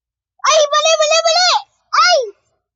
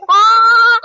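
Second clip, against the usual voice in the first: loud shout; 2 semitones higher, 8 dB louder than usual. Real, not acted.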